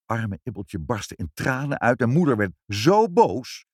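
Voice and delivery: ordinary delivery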